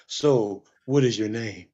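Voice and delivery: low, soft creepy pickup voice. Retold or imitated. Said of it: "[name] got the Bang Bus voice on".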